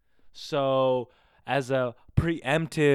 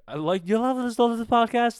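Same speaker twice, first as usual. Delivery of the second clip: "love this podcast?" pitched much higher and louder, then drops; starts really high; 11 semitones higher, 5 dB louder than usual. Shown as a demonstration. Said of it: "it starts really high and then it just suddenly drops".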